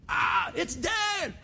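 high, slow, stretched shout of joy; thrilled child yelling that dad is calling; excited shout. Retold or imitated. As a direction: yell it like a kid hearing dad call. Kids excited when phone rings.

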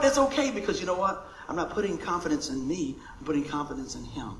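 ordinary talk

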